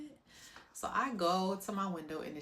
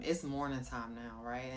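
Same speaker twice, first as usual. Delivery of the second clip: ordinary delivery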